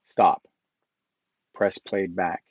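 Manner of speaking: ordinary talk, nothing marked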